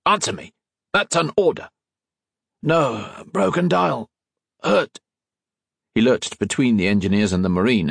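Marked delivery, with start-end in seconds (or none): 0.1-1.7 commanding sergeant, rising in pitch; stern order. Acted. Sergeant demands an answer. 2.6-5.0 high, strained broken phrases; halting, high-pitched injured soldier; disguised rasp. A narrator's reading. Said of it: "hoping to disguise his voice".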